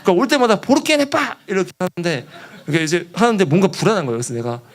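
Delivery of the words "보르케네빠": high-pitched cry in local language; mock calling out. Missionary repeating his stock local phrase, high mock call. Mimics phrase yelled at visitors.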